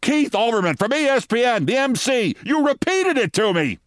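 high, exasperated shouted reminder; mock scream. Deputy mayor screaming the name at Giuliani, high mock yell. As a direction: yell it across the stage, at wits' end. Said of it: "Fran Ryder screamed".

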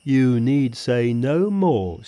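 a grumbling pig spirit, drawn out slowly; Pigsy. Acted.

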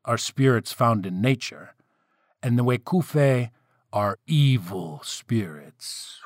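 plain reading voice

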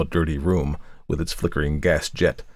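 ordinary delivery, nothing marked

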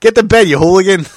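loud, high, laughing telling-off; hammed-up yell at a naughty friend; mock scolding. Teasing a friend up at three, mock scolding.

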